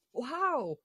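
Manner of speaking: ordinary talk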